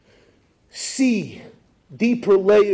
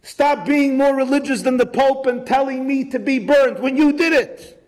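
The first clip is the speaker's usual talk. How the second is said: raised pitch, indignant protest; pleading, higher woman's voice; 7 semitones higher than usual. Pretend. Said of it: "Tamar could have said".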